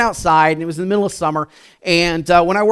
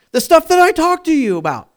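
high, louder whining insistence; mock exasperation; 12 semitones higher, 5 dB louder than usual. Husband reminds his wife of their talk, exasperated. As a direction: push it high in comic frustration.

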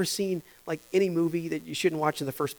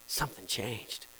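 softly, lower, in wonder; awed hush; 8 semitones lower, 12 dB quieter than usual. Retold or imitated. Baptized person telling of change, hushed in awe. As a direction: breathe it out amazed.

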